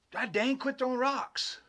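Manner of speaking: high, slow exasperated holler, retold; imitated angry yell. Les hit by a rock, imitated angry yell. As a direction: act out an annoyed friend calling out.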